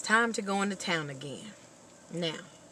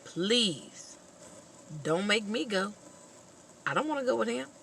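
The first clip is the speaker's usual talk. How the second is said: lightly voiced whining boy, a bit higher; a hint of a pleading son; 5 semitones higher than usual. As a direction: beg mama not to send him. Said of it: "The son".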